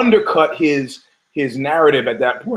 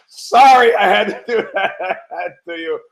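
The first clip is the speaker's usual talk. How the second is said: high, laughing through the words; real laughter breaking into speech; laughing apology; 7 semitones higher, 4 dB louder than usual. Real, not acted. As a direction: apologise while cracking up. Laughing after own punchline.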